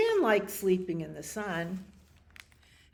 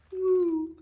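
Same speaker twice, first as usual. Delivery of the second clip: loud, high drawn-out woo; high hoot; 13 semitones higher, 7 dB louder than usual. Acted. Reader greets another cat appearing, high loud woo.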